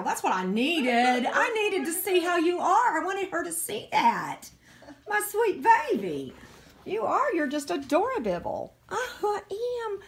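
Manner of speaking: high doting coo to a puppy. Real, not acted. Owner coos at her puppy in high doting baby talk.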